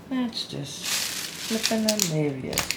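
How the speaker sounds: slow, dry, bitterly sarcastic. Real, not acted. Annoyed mum who can't find scissors, sarcastic. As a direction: drag it out, meaning the opposite. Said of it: "as you can hear, I'm not in the best of moods".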